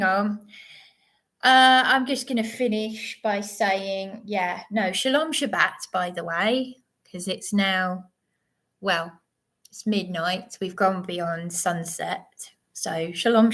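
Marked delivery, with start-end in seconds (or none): none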